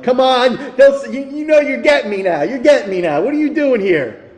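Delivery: delighted, high, loud playful dad voice; a father won over by his son, teasing with joy; melted dad. Retold or imitated. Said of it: "I'm like".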